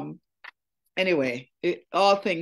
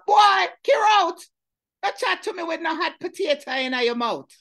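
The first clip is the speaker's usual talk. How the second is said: loud, high angry holler; mock holler; 5 dB louder than usual. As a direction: lose your temper and yell at him. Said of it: "me lost my temper and me holler".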